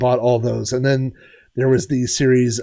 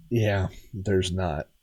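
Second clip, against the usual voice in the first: deadpan; 7 semitones lower, 8 dB quieter, much slower than usual. Retold or imitated. Deadpan reply to clerk.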